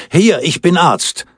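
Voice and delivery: high, urgent call from the audience. A narrator's reading. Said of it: "Gleichzeitig rief ein Mann".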